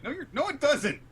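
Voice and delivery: very high, loud squawked denial; hammed-up outraged protest; exasperated mock shout. Law denies being Luffy's friend, exasperated mock shout.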